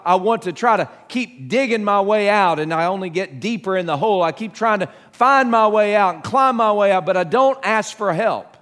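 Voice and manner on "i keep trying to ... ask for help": usual speaking voice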